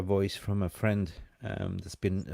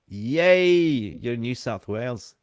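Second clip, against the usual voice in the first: excited cheer; 2 semitones higher, 8 dB louder, slower than usual. Real, not acted. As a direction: cheer it out for the viewer. Cheering a viewer's New South Wales location.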